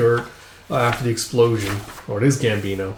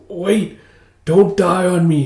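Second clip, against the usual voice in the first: mildly desperate, higher-pitched plea; stretched-out, raised begging; acted plea; 7 semitones higher, 4 dB louder, slower than usual. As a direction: plead slowly with someone slipping away. Pleading with wounded man.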